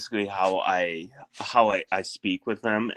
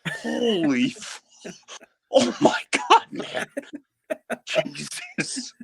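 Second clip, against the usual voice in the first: shocked exclamation; slower than usual. Retold or imitated. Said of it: "I'm like".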